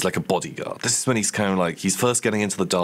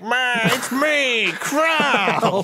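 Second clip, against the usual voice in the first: much higher, louder, slow and brash; Krell voice; 3 dB louder, much slower than usual. Acted. Krell bursting from the crypt to greet Heinrich, in a loud, much higher brash voice. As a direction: bellow like a thrilled dim-witted undead lug. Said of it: "that's more of the Krell voice".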